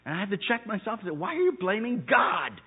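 loud, high preacher's climax; thundering pulpit voice; full-force preaching. Acted. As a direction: preach it out to the back row.

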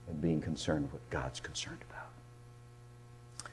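soft, near-whispered pulpit voice; hushed preaching. Acted. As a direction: draw them in with a hush.

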